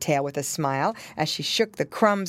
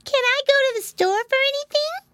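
put-on tiny, very high child voice; littlest pig; 5 dB louder than usual. A narrator's reading. Baby pig asking to go shopping, high eager voice. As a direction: ask in an eager baby squeak.

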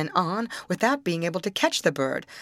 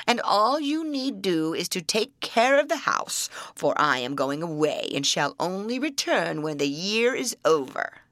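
troll voice. Acted. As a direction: growl it out like an old troll.

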